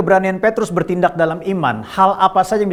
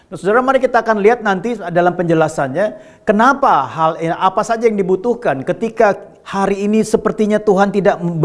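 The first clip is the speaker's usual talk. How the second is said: usual speaking voice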